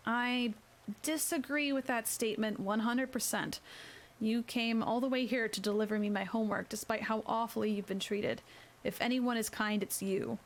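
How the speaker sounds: usual speaking voice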